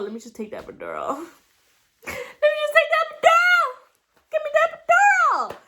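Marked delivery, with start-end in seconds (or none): 2.1-5.6 desperate shout. Retold or imitated. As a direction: scream the demand as if in labor pain. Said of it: "And then I'm like".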